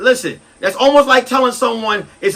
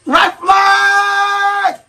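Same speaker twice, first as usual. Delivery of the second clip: high shouted warning; alarm call; 11 semitones higher, 3 dB louder, much slower than usual. Acted. Warning the audience, high shouted alarm. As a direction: sound the alarm.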